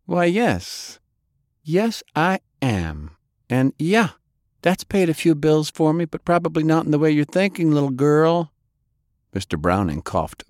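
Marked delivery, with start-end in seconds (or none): 0.1-8.5 higher, proud woman's voice with a bite; sultry dismissive woman.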